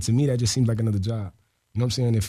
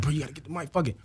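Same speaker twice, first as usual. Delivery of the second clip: hushed urging; 3 semitones higher, 8 dB quieter, faster than usual. Retold or imitated. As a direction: push him quietly to grab the mic. Friend urging quietly in panel.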